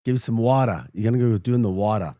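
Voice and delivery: dropped R's, New York style; mock New York accent on "water". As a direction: play up a New Yorker's "wawda". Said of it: "The R's".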